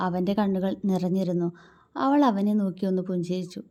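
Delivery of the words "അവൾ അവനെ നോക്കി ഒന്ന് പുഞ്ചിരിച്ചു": ordinary delivery, nothing marked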